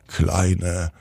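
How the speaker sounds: ordinary delivery, nothing marked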